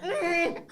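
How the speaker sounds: very high, soft pained mm; a groggy whimper after a leg drop; dazed moan. Retold or imitated. Wrestler with a smashed face, a soft high whimper. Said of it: "Sabin's like".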